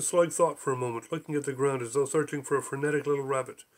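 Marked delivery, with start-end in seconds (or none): none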